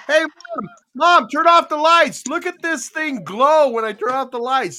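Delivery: put-on high, loud excited child calling mom; excited kid calling. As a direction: shout like a thrilled kid with a glowing toy. Child calling mom, retold scene.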